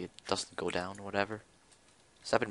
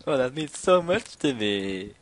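mock sentimental; 4 semitones higher, slower than usual. Mock touched response.

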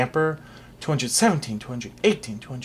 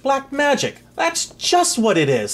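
relieved exclaim; 13 semitones higher, 4 dB louder than usual. Acted. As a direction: sound glad it has a name. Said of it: "sighed the king with relief".

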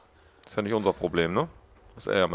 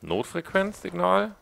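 surprised; 11 semitones higher, slower than usual. Real, not acted. Discovers emergency signal on map.

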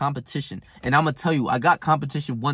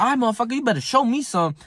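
higher, cocky taunt; mock trash-talk taunt; 7 semitones higher, faster than usual. Retelling the taunt at a teammate, cocky and higher. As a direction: dare him to bring it.

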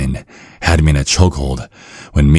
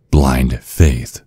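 ordinary talk, nothing marked

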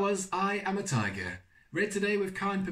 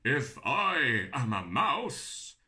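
slow challenge; 7 semitones lower, slower than usual. Acted.

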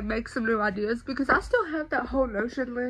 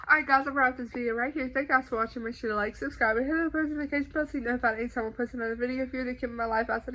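rushed, fast sign-off; fast outro; much faster than usual. Real, not acted. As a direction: rattle it off quickly. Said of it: "Let's try".

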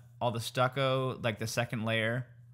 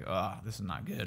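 groaning quietly; 5 semitones lower, 6 dB quieter than usual. Retold or imitated. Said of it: "I was just like".

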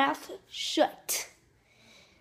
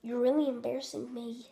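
ordinary talk

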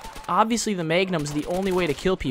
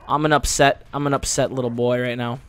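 usual speaking voice

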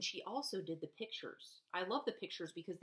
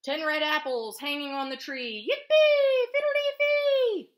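big bright storybook chant, shouted yippee; gleeful refrain; 7 semitones higher, 11 dB louder than usual. A narrator's reading. Teacher reading to preschoolers, loud gleeful chant.

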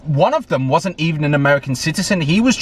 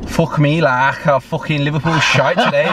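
Scouse accent; 2 semitones higher than usual. Shown as a demonstration. A fan moaning about Liverpool after a match, in an amateur Scouse accent. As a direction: have a go at a Liverpool accent, knowing it's a bad attempt. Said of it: "I might try and do it".

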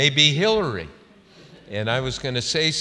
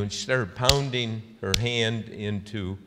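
ordinary delivery

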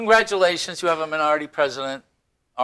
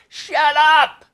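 shouted Shut up, much higher and louder; retold yell; 12 semitones higher, 7 dB louder than usual. At the polls, yelling at a heckler, full shout. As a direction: bark it right in his face. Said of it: "I yell in his face".

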